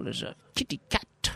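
high, hushed storyteller whisper; storytelling whisper. Shown as a demonstration. Telling Baron's joke to the co-host, hushed and high. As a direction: draw listeners in with a secret hush. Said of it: "focus, if you will".

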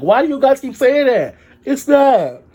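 loud, high-pitched exasperated retort; exasperated outburst. Retold or imitated. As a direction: push it up into an indignant yell. Retelling exasperated reply to doctors.

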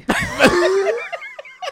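squealing laugh, way up high; high squeaky laugh. Real, not acted. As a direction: burst into a shrill giggle. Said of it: "What was that?".

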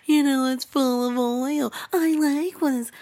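high, put-on cutesy voice; mocking girlfriend. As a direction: parrot her in a sugary mock voice. Mimics girlfriend's oil remark mockingly.

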